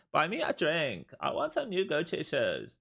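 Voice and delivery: high mock valley girl demands; spoiled LA girl asking for treats; valley girl impression. Streamer voicing the trading tool as a demanding valley girl, high whine. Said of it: "That's what I think N Q sounds like".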